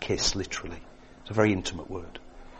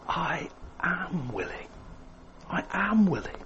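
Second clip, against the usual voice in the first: tender reassurance; 7 semitones higher, 8 dB quieter than usual. Retold or imitated. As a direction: breathe it out as a tender promise. Jesus answers the leper's plea.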